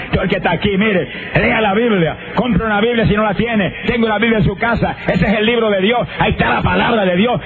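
fiery preaching. Acted. Preacher urges everyone present to buy and read the Bible, fiery exhortation. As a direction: preach it to the whole church.

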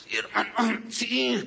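acted hoarse voice; imitating a voice gone hoarse; ronco. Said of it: "me puse todo ronco". (imitando una voz ronca)

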